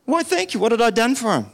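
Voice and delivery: high-pitched retold bafflement; puzzled reaction. Driver reacts to stranger's thanks, puzzled and high-pitched. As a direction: wonder aloud, voice shooting up high.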